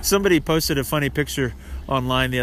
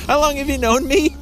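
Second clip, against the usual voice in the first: mock indignation; 11 semitones higher, 4 dB louder than usual.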